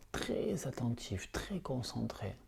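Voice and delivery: fading to a slow breathy whisper. Acted. Teacher deepening students' focus during yoga nidra, whispering. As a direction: let the words dissolve into a whisper. Guiding concentration in meditation.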